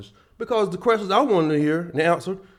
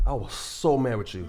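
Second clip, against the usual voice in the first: normal talking voice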